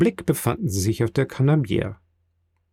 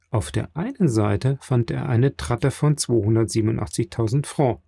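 ordinary delivery

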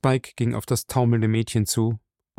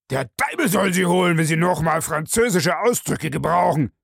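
raised, explosive cursing; angry outburst; 7 semitones higher than usual. A narrator's reading. Bellamy curses butler for using French, bursting out angrily. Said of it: "brach Bellamy los".

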